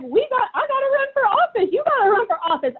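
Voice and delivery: high, animated retold rallying cry; fired-up urging. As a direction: recount it as an urgent, excited push. Retells her fired-up urging to run.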